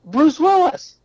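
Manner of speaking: excited recognition. Retold or imitated.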